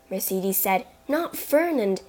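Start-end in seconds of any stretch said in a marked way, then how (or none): 1.1-2.0 protesting. Acted. Mercedes denies.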